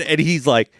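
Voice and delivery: usual speaking voice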